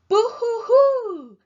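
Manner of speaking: loud, high, slow mock sobbing boo-hoo; hammed-up boo-hoo crying. A narrator's reading. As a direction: wail it like a cartoon crybaby. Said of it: "cried Piggy's brother".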